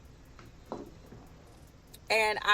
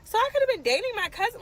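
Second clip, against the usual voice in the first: horrified; 13 semitones higher, 8 dB louder, faster than usual. Real, not acted. Horror at dating a cousin.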